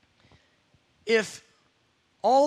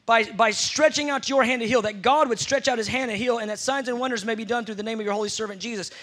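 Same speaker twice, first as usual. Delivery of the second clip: ordinary delivery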